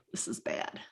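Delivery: a hushed aside of horror; whispered dismay. Real, not acted. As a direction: whisper it, aghast. Reacting in dismay.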